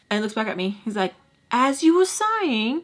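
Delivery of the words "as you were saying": higher, slowed smug line; smug Charlie. Retold or imitated. Charlie turning back to her after Sugar, high and slow. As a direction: drag it out coolly.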